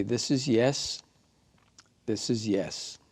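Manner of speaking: spoken slowly, leaning on is; calm teacher demonstration; slow demo phrase. Shown as a demonstration. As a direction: model the phrase for students to repeat. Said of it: "Just say".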